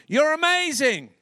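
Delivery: high, projected mock shout; shout into valley. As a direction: holler praise across a valley. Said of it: "he shouted into the valley".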